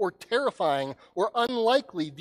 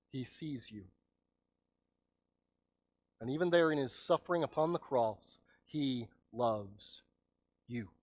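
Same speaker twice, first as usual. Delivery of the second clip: tender hush; 5 semitones lower, 7 dB quieter than usual. Acted. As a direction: lean in and say it gently. Tender climax of crucifixion scene.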